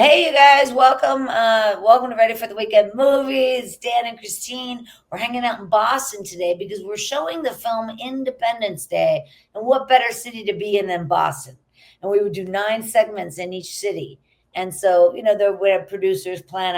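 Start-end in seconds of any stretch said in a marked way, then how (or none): 0.0-11.6 big upbeat host intro; bright announcer welcome. Retold or imitated.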